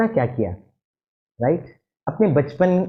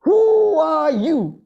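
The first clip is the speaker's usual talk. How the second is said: loud, high drawn-out "Who are you?"; shouted; 9 semitones higher, 5 dB louder, much slower than usual. Retold or imitated. Japanese president shouts at the American president to be heard. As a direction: bellow it at the top of your voice. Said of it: "shouted at his top of his voice".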